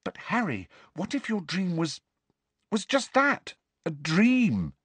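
high-pitched, careful questioning; tentative doubt. A narrator's reading. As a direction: gently suggest a doubt, pausing midway. Timid doubt before friend's outburst.

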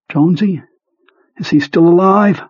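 high, nervous friend voice; Beaver's anxious voice. Acted. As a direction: ask it scared and high. Beaver frightened, asks friend.

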